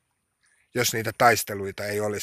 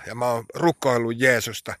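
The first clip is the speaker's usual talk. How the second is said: ordinary delivery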